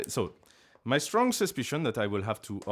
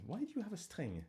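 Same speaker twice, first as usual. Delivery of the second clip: normal talking voice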